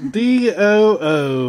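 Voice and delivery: each letter called out slowly; loud slow letter reveal; spelled out loud and slow. Acted. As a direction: announce the letters.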